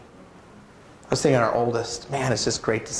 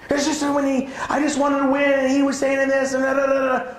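put-on child explaining why he fought; child's whiny excuse; 12 semitones higher than usual. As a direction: whine like a kid defending himself.

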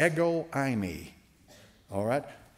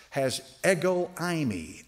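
ordinary talk, nothing marked

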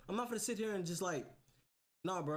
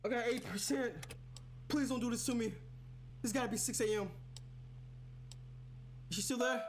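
panicked; 6 semitones higher than usual. Real, not acted. Low power, panicking in horror game.

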